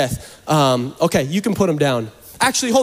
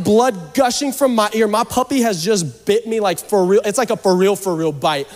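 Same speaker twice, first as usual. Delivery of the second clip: usual speaking voice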